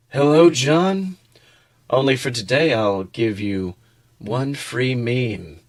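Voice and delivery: slow sinister meme dealer; sly dealer voice. Acted. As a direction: tempt him slowly, like a dealer. Dealer offers a free meme.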